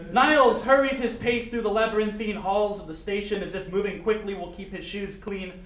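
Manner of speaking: lively storyteller read; story narration. Acted. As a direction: narrate it like a silly audiobook. Reads fan fiction aloud.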